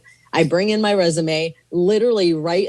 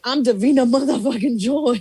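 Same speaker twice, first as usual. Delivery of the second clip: higher-pitched, cocky self-announcement; self-assured boast; 7 semitones higher than usual. Retold or imitated. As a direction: announce yourself with playful swagger. Acting out her bold entrance.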